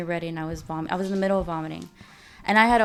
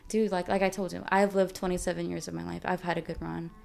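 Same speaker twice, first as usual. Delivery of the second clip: ordinary talk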